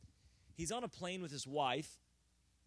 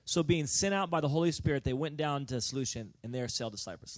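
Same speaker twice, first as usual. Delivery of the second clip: slow, loud pulpit reading; loud, deliberate preacher reading; loud scripture reading; 12 dB louder than usual. Acted. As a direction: proclaim the passage to the congregation.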